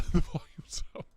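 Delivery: laughing whisper. Real, not acted. To chat, whispering through a laugh. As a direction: breathe it out on a giggle.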